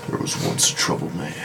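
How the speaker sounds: low breathy movie-trailer narrator; dramatic narrator voice. Retold or imitated. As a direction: intone it like a solemn intro. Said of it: "intro to be like".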